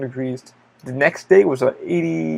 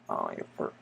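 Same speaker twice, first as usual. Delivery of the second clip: whispered aside; 16 dB quieter than usual. Real, not acted. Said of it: "I feel like I got a fart".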